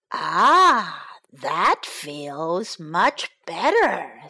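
low, slow sigh of relief; soothed well; relieved sigh. Acted. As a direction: sink into comfort.

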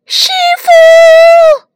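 very high, long-held scream; great shout. A narrator's reading. Nezha calls skyward to his master, screaming. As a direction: cry to the sky, stretching the last syllable. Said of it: "仰天大喊".

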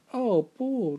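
high, loud oh no; hammed-up loud gasp of dismay. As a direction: cry out in storybook alarm. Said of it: "猪妈妈大声说".

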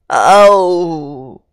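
low loud groan of defeat; pained howl. Acted. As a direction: howl as you collapse. Porcupine collapses with a cry.

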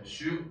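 シュ as one syllable, "shu"; on-reading シュ. Shown as a demonstration. Teacher showing students the on-reading, demonstrated pronunciation. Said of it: "它这个音读念成シュ".

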